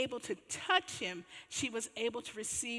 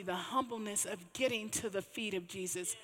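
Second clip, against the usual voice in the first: ordinary talk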